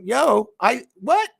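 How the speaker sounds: what jumps way up high, baffled; startled squeak of confusion; yelping what in disbelief; shocked what. Retold or imitated. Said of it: "he says".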